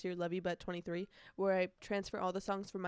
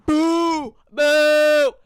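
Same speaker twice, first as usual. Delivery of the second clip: much louder, high booing; loud mock boo; 7 semitones higher, 18 dB louder than usual. Fan booing Jeremiah and Belly's kiss, loud boo. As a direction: boo them like a rival fan.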